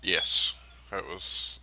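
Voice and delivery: ordinary delivery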